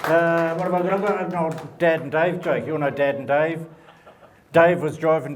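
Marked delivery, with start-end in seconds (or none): none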